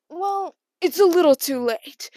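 a sly told-you-so; sassy reveal, pushed out loud; cheeky. Retold or imitated.

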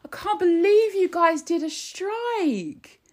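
very high-pitched, exaggerated outraged disbelief; mock complaining. Commentator imitating government complaining that workers went on strike, hammed-up disbelief. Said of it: "they'll complain and they'll be like".

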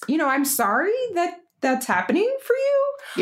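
high, hesitant mock consoling; acted awkward sympathy; put-on sympathy. Acted sympathy for crying stranger.

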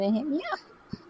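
voice cracking up an octave. Real, not acted. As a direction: voice cracks up high mid-phrase. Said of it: "Sorry, my voice just cracked".

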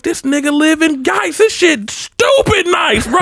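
very high, stretched-out amazement; amazed hype. Retold or imitated. Homie reporting on the fancy house, hyped. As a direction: imitate an awestruck friend, voice way up.

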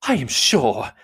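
mildly gushing mother; fussy, over-grateful matron; profuse thanks. A narrator's reading. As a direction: begin effusively. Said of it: "was profuse in her acknowledgments".

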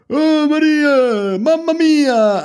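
high, drawn-out mock Italian cry; Italian street shouting. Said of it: "shouting on the streets is all, like, Italian".

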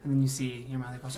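ordinary talk

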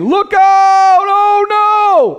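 high, loud mock alarm; mock panic. Preacher mimicking the panicked voices of earth, mock fright. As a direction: squeal it like a frightened worldly voice. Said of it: "voices on earth who are saying".

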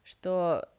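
usual speaking voice

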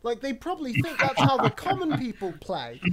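laughing through a mocking jab; laughing disbelief at posh leaders. Real, not acted. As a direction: giggle through the line.

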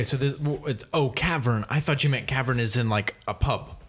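usual speaking voice

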